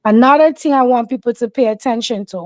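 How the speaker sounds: ordinary delivery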